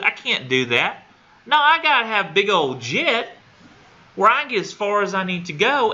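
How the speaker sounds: hammed-up preacher bragging to his cohost; boastful televangelist. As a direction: brag like a smug TV preacher selling his jets. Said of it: "He goes".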